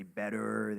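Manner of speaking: "better" with an American R; drawn-out American "better" with a strong final R. Shown as a demonstration. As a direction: say "better" from the back of the throat, American style. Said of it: "speak with an American accent".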